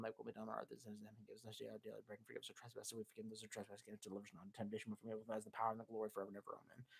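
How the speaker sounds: very fast, low, barely audible praying; muttered prayer. Acted. Streamer praying to calm himself after ranting, muttered. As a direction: rattle off prayers under your breath to calm down.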